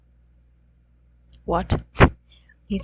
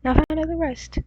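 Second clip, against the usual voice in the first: higher and louder, a teasing announcer's tagline; goofy dramatic tagline; 7 semitones higher, 5 dB louder than usual. Shown as a demonstration. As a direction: sell it like a silly cliffhanger. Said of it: "and then find out the res".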